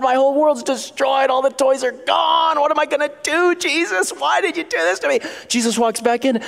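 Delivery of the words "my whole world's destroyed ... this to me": hammed-up high, distraught wail; crying kid. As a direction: wail like a devastated child, drawing out words. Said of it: "He's crying".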